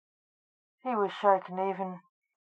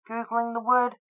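ordinary delivery, nothing marked

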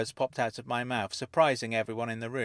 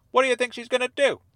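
high, loud challenge; scoffing; 13 semitones higher, 10 dB louder, faster than usual. Acted. Dennis answering the narrator's safety worry, loud and scoffing. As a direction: dismiss the worry loudly.

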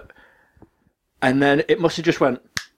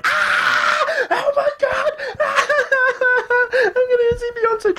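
shrieking, laughing and half crying with joy, much higher; thrilled scream, laughing through near-tears; overjoyed squeal; slower than usual. Retold or imitated. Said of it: "Just, like, really happy".

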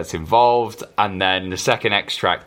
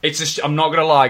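excited; 8 semitones higher, 4 dB louder, much faster than usual. Real, not acted. Excited reaction.

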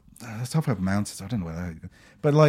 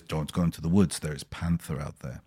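imitating the man's warning; 10 semitones lower, 10 dB quieter than usual.

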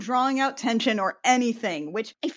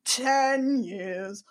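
slow dramatic drawl; 2 semitones higher, much slower than usual. Retold or imitated. Echoes dramatic line.

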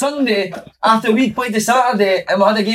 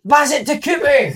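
high-pitched mock Fraserburgh accent; 8 semitones higher than usual. Fraserburgh players calling to a teammate mid-match, broad Fraserburgh accent. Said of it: "you can imagine the accent".

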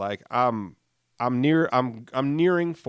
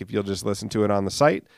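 usual speaking voice

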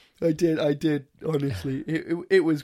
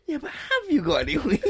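high-pitched imitation of a friend; high mock-curious question; Lucianus; 12 semitones higher than usual. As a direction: ask it as an impression, voice pitched up. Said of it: "Lucianus turns around and goes".

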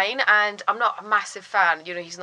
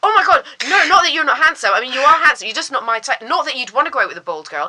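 high, loud, laughing over-explanation; nervous babbling; 5 semitones higher, 7 dB louder than usual. Retold or imitated. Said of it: "the more I was getting nervous".

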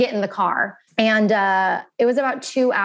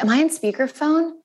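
higher, raised repeat of the question; a sister repeats it up high because the line is bad, mildly raised; repeating louder; 6 semitones higher than usual. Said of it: "I couldn't hear her".

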